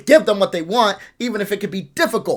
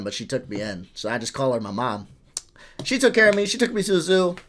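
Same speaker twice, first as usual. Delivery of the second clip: usual speaking voice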